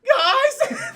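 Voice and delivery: high, loud whiny call for the others; plaintive calling out. Retold or imitated. Lost man yelling after his group, high and loud. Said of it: "He's like".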